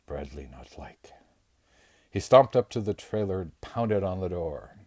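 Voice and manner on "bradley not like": a sulky whisper under his breath; whispered to himself. A narrator's reading. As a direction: whisper it, dim strongman muttering. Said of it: "he said to himself".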